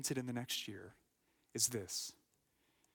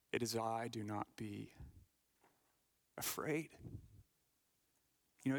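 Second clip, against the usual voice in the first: ordinary talk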